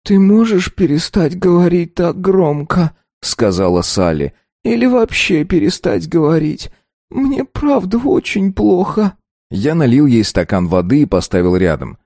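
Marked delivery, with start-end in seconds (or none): 0.0-3.0 high, slow, weary woman's voice; plead, feeling sick; hungover young woman. A narrator's reading. Hungover Sally complains. 4.6-9.2 high, slow, weary woman's voice; plead, feeling sick; hungover young woman. A narrator's reading. Hungover Sally complains.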